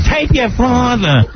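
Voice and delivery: high, slow imitation of a bossy mother; mom's order. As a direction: order the kid, pitched high and drawn out. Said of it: "So my mom goes".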